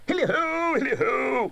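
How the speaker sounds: high, hearty calling voice; a fat jolly master hailing his apprentices; jovial Fezziwig. A narrator's reading. Fezziwig calling his apprentices, jovial and hearty. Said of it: "cried old Fezziwig".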